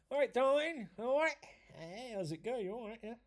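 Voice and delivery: mock a lad trying to pull at the bar; cheesy chat-up voice. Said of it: "I don't talk like that".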